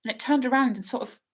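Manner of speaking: put-on a nervous young girl stumbling over her words; anxious girl's voice. Hermione's voice in reading.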